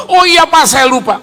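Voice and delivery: loud, high-pitched exclamation; acted "oops, I forgot" outburst; sudden realisation. Acted quote in retold anecdote.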